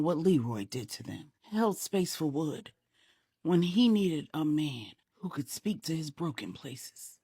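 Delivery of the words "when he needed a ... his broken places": usual speaking voice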